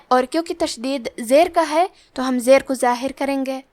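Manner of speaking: usual speaking voice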